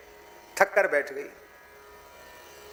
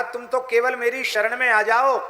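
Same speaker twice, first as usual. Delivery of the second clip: raised, louder divine reassurance; God urging devotees to take refuge, raised voice; God's loving promise; 6 semitones higher, 3 dB louder than usual. Acted. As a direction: speak as God promising to protect. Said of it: "मानो भगवान ने कहा".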